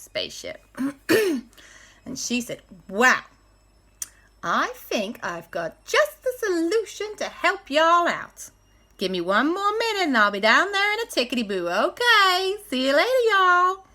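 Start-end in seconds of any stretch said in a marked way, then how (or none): 2.9-13.8 put-on Nashville accent. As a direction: talk like a Nashville local. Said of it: "like she's got an accent exactly like Nashville".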